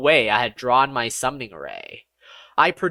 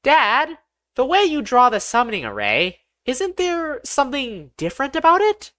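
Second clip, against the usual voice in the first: put-on high, light little boy voice asking innocently; a curious nine-year-old tugging at his dad; innocent child; 10 semitones higher than usual. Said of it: "asked innocently".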